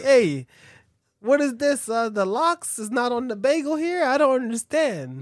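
high, loud hammed-up Yiddish complainer; loud mock Yiddish griping about his bagel; kvetching Yiddish shop customer; mock Yiddish accent. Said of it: "It's like".

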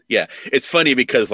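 ordinary talk

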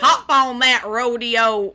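loud, slow cowboy drawl; mock cowboy. Cowboy urges little lady onto rodeo, mock drawl.

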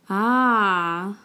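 an open, settled ah as if it just clicked; ah of understanding. Shown as a demonstration. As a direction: say ah like you finally get it. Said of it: "as in I understand".

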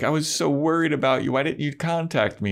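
high, hurried imitation of fretting; mock worried girlfriend. Retelling Buffy's worried reproach to Angel.

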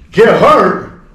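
loud, high-pitched retort; mock-outraged drunk player; incredulous challenge. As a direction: throw it back loudly in disbelief.

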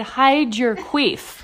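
stretched out slowly; drawn-out mock phrase; slow teasing imitation; slow mock quote. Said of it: "she said".

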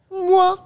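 high laughing smooch; kiss sound. Acted. Sound effect of a kiss for the kissy emoji, laughing and high. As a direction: blow a loud, high kiss through a laugh. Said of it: "I couldn't do a good kissing sound effect".

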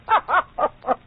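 hearty mocking laugh; scoffing laugh. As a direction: laugh off the accusation. Scoffing at being called a seducer.

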